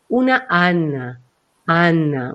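"Ana" low and slow, set back; voice placed behind. Shown as a demonstration. Voice coach demonstrates the name Ana, low and placed back. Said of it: "Uno puede sentir que está detrás".

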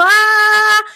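a sudden high, loud "Ah" pushed up from the throat; shrill forced ah. Shown as a demonstration. Voice coach showing a useless, strained A sound. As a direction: blurt a tight, high "Ah". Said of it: "esa A no sirve para nada".

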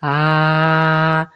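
"ah" sung low and steady from the chest. Shown as a demonstration. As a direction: hold a calm, deep "ah" with a hand on the heart. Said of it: "ponerse la mano y de sentir". ("ah" grave y sostenido desde el pecho)

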